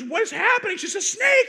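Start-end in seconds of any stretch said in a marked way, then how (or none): 0.0-0.8 alarmed shout. Retold or imitated. Naked husband bursts in. 1.1-1.5 very high, loud yelp; panicked yelp. Retold or imitated. Wife answers panicked.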